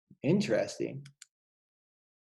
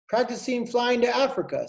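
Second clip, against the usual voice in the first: higher, proud little bird; lightly voiced swallow; 9 semitones higher than usual. Swallow Apollo telling Jack his plan, high and proud. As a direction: say it high and pleased.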